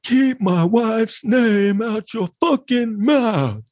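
high, slow furious shout; Will Smith impression. Vlogger's Will Smith impression, slow high shouting. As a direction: yell it like the Oscars slap. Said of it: "That's my Will Smith impression".

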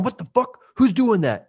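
man squirted in the head, shocked and annoyed; startled outrage. Retold or imitated. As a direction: spin round in shock. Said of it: "squirt a guy in the back of the head".

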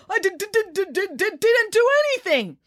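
very high, panicked stutter; frightened stammer. Acted. Boy grabbed by the collar protesting innocence, panicked stutter. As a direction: stammer helplessly, far up in pitch.

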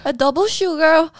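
mock demanding customer; rattling off an order. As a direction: reel off a fussy order. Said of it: "and it's like".

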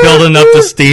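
mock locomotive. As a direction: puff it out like a train building steam.

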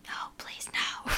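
retold refusal in a tiny, voiceless whisper; gefluisterde smeekbede. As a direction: whisper a plea, barely audible. Retells panicked refusal of phone.

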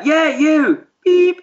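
hammed-up heckle aimed at someone across the road; retold yell. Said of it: "and then it's just like".

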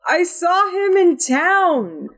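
high, drawn-out worried questioning; Carpathius. Acted. Doubting news of a man seen in town, high incredulous character voice. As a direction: pitch it way up and stretch the words in disbelief. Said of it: "Carpathius goes".

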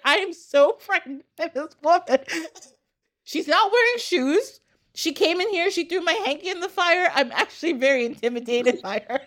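mock frightened queen. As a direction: play the queen as nervously intimidated. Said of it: "And he was like".

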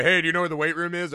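fast, eager goofy big-guy voice; a quick mock Tommy Boy bit, eager and goofy; Chris Farley impression. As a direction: ham it up like a clumsy, overeager party guy. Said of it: "It's like Chris Farley and Tommy Boy".

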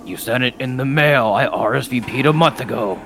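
smug demon sneering back; Baphomet voice. Acted. Baphomet retorts to groom.

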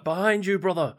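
high-pitched battle warning to a companion; companion's high alert cry; urgent warning call. Acted. Voicing game companion's battle warning.

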